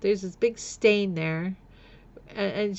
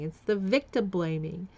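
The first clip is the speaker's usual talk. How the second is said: normal talking voice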